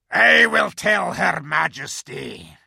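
loud, slow growling wolf; menacing guard wolf. A narrator's reading. Wolf figure voiced.

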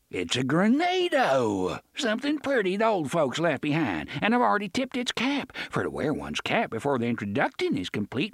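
high, mocking old buccaneer; sly pirate voice. A narrator's reading. Pirate Gasher speaks.

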